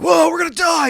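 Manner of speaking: high, loud hammed-up panic cry; mock panic. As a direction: squeal it like a spooked chimp. Avoidant partner panics at nothing.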